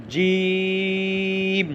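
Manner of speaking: "জিম" with a very long, steady ee before the closing m; four-alif elongation. Shown as a demonstration. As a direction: stretch the vowel in "জিম" for four alifs. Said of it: "এরকম চার আলিফ পরিমাণ টেনে".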